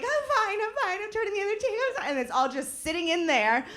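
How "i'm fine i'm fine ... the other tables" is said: high, quiet, fast polite cover; fake composure. Swallowing anger at the door, high quiet fake calm. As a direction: squeak out forced calm. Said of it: "But instead you're like".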